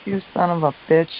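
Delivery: loud, exasperated swearing; retold angry cursing. Woken at three thirty, cursing the culprits, exasperated. Retelling cursing those who wake her.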